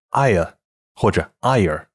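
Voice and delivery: ire said slowly, gliding from a wide ah; slow demo of the mistaken ire; wrong ire sound. Shown as a demonstration. Teacher shows what learners wrongly say. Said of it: "否则这个双元音也就错误地发成了".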